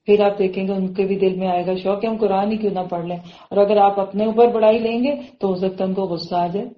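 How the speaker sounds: normal talking voice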